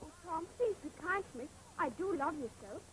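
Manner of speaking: softly pleading with her brother; a sister begging gently for kindness; tender plea. Acted.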